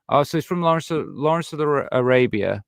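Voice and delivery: tripping over the name with false starts; stumble, restart, then get the word out; real tongue-tied restarts; tongue-tied stumbling. Real, not acted. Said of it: "I don't know why I'm talking like this".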